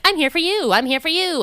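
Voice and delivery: imitated over-keen announcement; eager calling out. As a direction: call it out eagerly to someone approaching. Retold eager greeting to approaching stranger.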